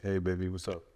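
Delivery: low, soft, sleepy gravel; gravelly morning voice. Shown as a demonstration. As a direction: sound just woken up. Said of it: "that gravelly morning voice".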